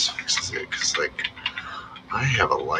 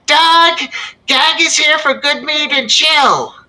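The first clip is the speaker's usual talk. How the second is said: high, loud effeminate booming call; loud effeminate baritone; 7 dB louder than usual. Acted. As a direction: announce yourself like a flamboyant giant. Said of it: "very loud baritone, but effeminate voice".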